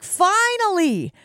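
high, slow mock-relieved sigh; relieved coach voice. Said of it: "He may be like".

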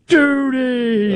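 high, drawn-out mock yell; long high-pitched cry, no real force; imitated cartoon yell. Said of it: "and just yells out".

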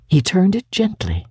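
plain reading voice

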